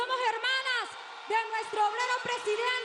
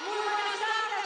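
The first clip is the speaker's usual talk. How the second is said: usual speaking voice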